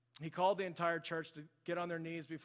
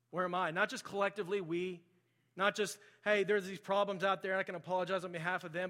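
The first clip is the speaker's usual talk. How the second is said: raised, louder preaching voice; forceful preaching; 3 semitones higher, 8 dB louder than usual. Real, not acted. Preacher challenges church on personal hypocrisy, preaching forcefully. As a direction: press the question on the congregation with force.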